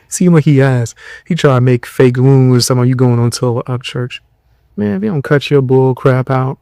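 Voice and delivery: ordinary talk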